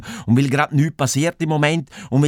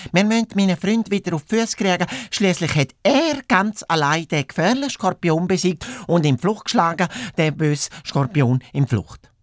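lightly voiced hare, higher-pitched; chatty hare; 7 semitones higher than usual. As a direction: explain it as the talkative hare. (leicht angedeutete hohe Hasenstimme)

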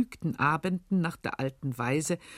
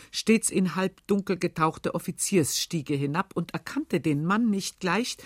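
usual speaking voice

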